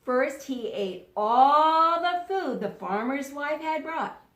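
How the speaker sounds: ordinary talk, nothing marked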